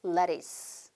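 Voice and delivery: lettuce said "leris" with a soft flapped t. Shown as a demonstration. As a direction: say lettuce like "laris", not "레투스". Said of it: "한 번 더요". (lettuce를 레투스가 아닌 "레리스"처럼 굴려서)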